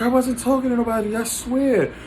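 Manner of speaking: high, pleading mock girlfriend voice; girlfriend's denial. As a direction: pitch it up and plead innocence like she did. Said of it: "She's like".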